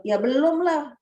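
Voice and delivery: high-pitched, offhand mock protest; retold careless blurt. Young woman answering about the hajj, retold careless blurt. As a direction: blurt it out as an obvious no, imitating your younger self. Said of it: "Saya ya keceplosan".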